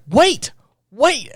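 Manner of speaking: high, far-off mock plea of "wait"; voicing Hikaru as he runs to the door, a high mock plea; Hikaru calling after her. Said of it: "runs to the door and is like".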